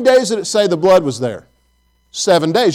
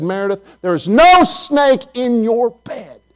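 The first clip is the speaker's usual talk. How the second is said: exasperated dad; 5 semitones higher, slower than usual. Retold or imitated. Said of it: "I said".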